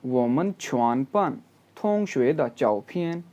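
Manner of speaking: Chinese sentence read very slowly, word by word. Shown as a demonstration. Teacher reading the Chinese text slowly aloud. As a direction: spell out each syllable of the text. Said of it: "text को pronounce करते हैं".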